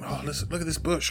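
lightly hushed, fast, fussing over a bush; mock nature-recordist voice. Two podcasters poking bushes with a recorder, hushed mock enthusiasm. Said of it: "which is just us going around like".

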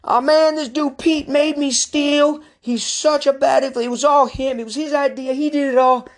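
high whine, pinning all the blame; whiny friend blaming. Retold or imitated. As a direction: whine to mom and throw your buddy under the bus. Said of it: "on the phone with his mom saying".

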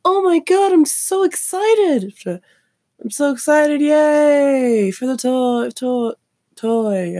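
shrill-high, loud fake excited squeal; hammed-up child fake joy; mock squealing excitement. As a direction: parody a kid forced to gush over a toy. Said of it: "He was".

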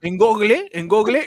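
"Google" as "GOG-leh", final e held; dad's mispronunciation. Retold or imitated. Mimicking his dad's version, "Google" as "GOG-leh". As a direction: say "Google" as spelled in Spanish, stretching the -leh. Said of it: "va a ser como dice mi viejo".

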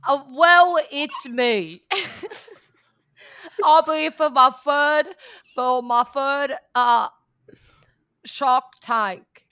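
hammed-up Boston drawl, loud; loud cartoonish Boston accent. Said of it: "Boston accent or British?".

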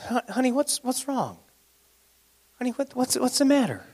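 high, soft, syrupy coaxing; sweet wheedling wife. Retold or imitated. Jezebel coaxing her sulking husband, high and sugary. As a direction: coo at him like a doting wife. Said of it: "she said".

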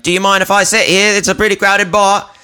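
high, fast nervous stranger impression; high mimic voice. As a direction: squeak it out like an awkward stranger. Mimicking the odd guy.

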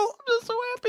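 very high, squealing mock glee; tiny falsetto mock delight; mock joy. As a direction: squeal it like a giddy child. Gushing over his Christmas miracle.

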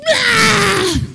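long high strangled scream; mock freak-out scream. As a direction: burst into a held comic shriek. Acted overflow of emotions.

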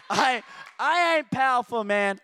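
slow, laughing brush-off; laughing denial. Real, not acted. Laughs off the praise.

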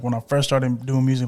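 ordinary delivery

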